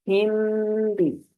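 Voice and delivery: "हिम" held as a hum that melts into "भी"; hidden meem. Shown as a demonstration. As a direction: hum the end of "हिम" through the nose, then release "भी". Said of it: "मीम की आवाज बा में छुप जाएगी".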